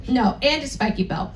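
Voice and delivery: ordinary talk, nothing marked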